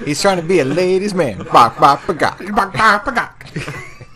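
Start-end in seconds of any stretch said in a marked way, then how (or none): 1.5-4.0 high clucking chicken noises. Pretend. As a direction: cluck like a hen, rising higher. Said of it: "that's exactly what you sounded like".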